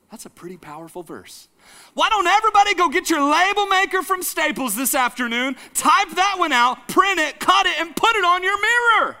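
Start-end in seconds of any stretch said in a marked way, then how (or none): 1.9-9.2 fervent preaching. Acted. Preaching at raised force.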